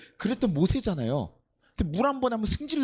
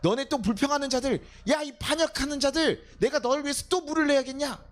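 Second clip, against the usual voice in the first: much higher, indignant rebuke; acted angry outburst; 8 semitones higher than usual. Moses berating the complainers at the rock, acted angry outburst. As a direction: berate the complainers, pitch raised throughout. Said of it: "화를 내면서".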